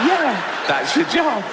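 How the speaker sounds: high, fluttery camp yes; camp poodle. Acted. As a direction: play a prissy little poodle. Poodle answering camply.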